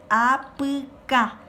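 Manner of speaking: "Apuka" drawn out very slowly, syllable by syllable; very slow teacher's "Apuka"; slow model pronunciation. Shown as a demonstration. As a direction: stretch "A-pu-ka" for pupils to copy.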